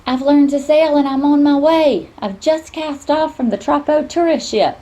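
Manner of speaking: put-on high, loud, bubbly young voice; bursting with news; excited girl on the phone.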